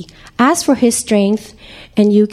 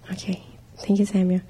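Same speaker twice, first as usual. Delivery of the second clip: whispered aside; 3 semitones lower, 10 dB quieter, much faster than usual. Real, not acted. Aside to helper mid-talk.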